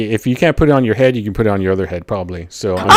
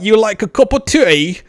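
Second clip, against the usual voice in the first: higher, hammed-up Cockney offer of tea; Cockney accent; 10 semitones higher than usual. Retelling his drifting accent to a friend, Cockney. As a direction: play a stage Cockney. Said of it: "it turned into a Cockney accent".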